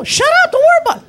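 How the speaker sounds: very high, loud shout. Retold or imitated. Driver shouting his friend down, very high. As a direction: scream it to end the argument. Acted car quarrel.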